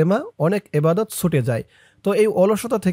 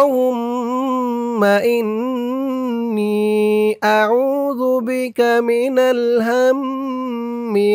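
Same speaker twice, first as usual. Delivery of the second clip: Quran-style recitation; 5 semitones higher, much slower than usual. Acted. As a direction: draw the whole dua out in tilawat tone. Recites the dua in full. (ধীর সুরে দোয়া তিলাওয়াত)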